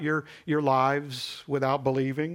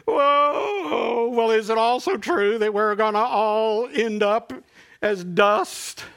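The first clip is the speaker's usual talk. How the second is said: put-on much higher and louder, a startled child's voice; alarmed little boy; 8 semitones higher, 7 dB louder, slower than usual. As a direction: squeal it like a boy who just got scared. Boy reacts in joke.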